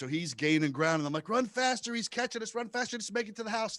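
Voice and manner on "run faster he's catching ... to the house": frantic urging. Retold or imitated. Chased by hillbilly.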